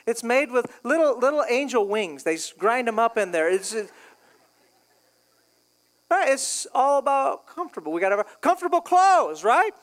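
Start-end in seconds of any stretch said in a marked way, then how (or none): none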